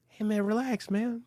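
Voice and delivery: calm him down like an amused jock; mock calming. Jock calming the panicking guy.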